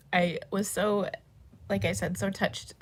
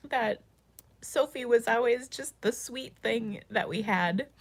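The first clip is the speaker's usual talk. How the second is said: crying; 4 semitones higher than usual. Real, not acted. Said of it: "I'm gonna stop crying now".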